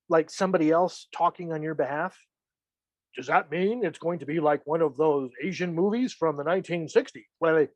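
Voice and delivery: ordinary talk, nothing marked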